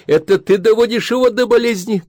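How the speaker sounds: shout. A narrator's reading. Wife shouting blame at husband, high and loud. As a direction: hurl the blame at him. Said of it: "крикнула Людмила Аркадьевна".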